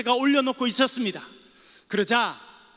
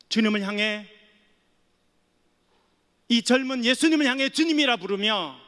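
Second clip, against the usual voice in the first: ordinary talk, nothing marked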